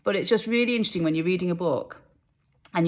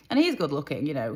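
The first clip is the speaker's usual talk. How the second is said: ordinary talk, nothing marked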